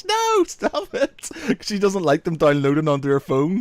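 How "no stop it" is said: high, slow mock protest; a high, drawn-out posh mum's "no"; imitating posh mother. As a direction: squeal it like a prim mum. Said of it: "she's like".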